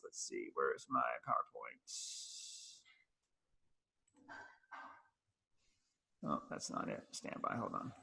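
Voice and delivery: far from the mic, muttering to self; quiet off-mic aside while searching for slides. Real, not acted. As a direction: drift away from the microphone and talk under your breath.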